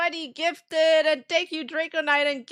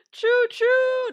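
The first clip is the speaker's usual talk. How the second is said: train whistle call; 11 semitones higher, 6 dB louder than usual. Real, not acted. Hype train starts.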